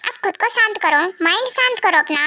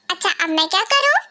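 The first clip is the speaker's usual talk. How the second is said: normal talking voice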